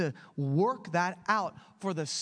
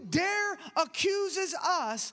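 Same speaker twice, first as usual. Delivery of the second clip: preaching; 10 semitones higher, slower than usual. Acted. Reading scripture with force.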